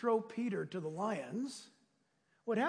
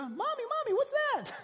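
put-on much higher little child's squeak; baby zebra voice. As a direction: ask it like a frightened little foal. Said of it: "a little baby zebra".

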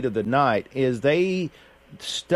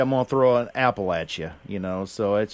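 ordinary talk

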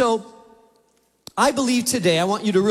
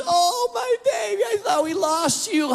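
put-on much higher, gushing "Oh, my baby"; wife's joyful cry; 11 semitones higher than usual. Wife greeting the found dog, much higher and overjoyed. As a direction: gush over the found dog like a long-lost sister. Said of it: "She was".

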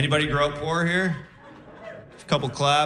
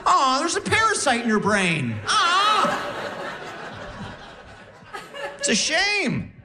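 high, cooing fake sympathy; mock pity; 12 semitones higher than usual. Boyfriend reacts to her gibberish, high mock-sympathetic coo.

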